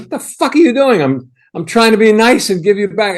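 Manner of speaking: high, loud mock yell of cursing; retold shouting back. Young man shouting curses back at his boss, loud. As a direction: fire the curses straight back at him. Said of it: "curse words back to him".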